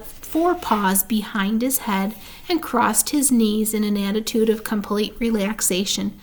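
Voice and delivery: usual speaking voice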